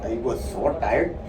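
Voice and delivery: low, soft attempt at an Indian accent; too quiet. Shown as a demonstration. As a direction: try the Indian accent timidly under your voice. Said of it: "speak loud".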